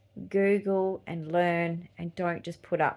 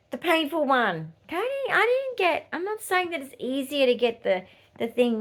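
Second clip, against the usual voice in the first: ordinary talk, nothing marked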